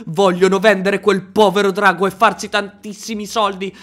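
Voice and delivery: usual speaking voice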